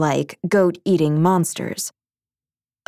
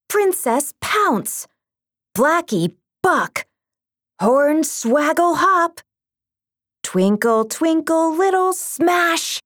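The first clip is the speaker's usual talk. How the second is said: high, slow excited move names; battle cries; 9 semitones higher, slower than usual. A narrator's reading. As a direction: announce each attack with flair.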